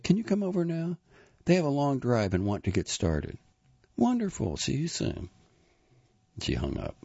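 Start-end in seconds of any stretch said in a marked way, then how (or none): 0.0-5.3 high, lilting phone manner; put-on cheery phone voice. A narrator's reading. Olive phoning Jack to come over, high and cheery. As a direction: pitch the phone chat well up.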